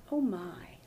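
hushed, breathless whisper; whispered thought. Retold or imitated. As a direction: mutter it to yourself under your breath.

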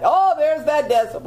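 mock kid. Kid imitated hearing mom.

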